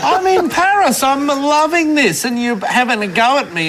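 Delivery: high-pitched incredulous protest; mock outrage, pitch easing down; indignant. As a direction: protest in comic disbelief, starting very high. Retold protest to rude stranger.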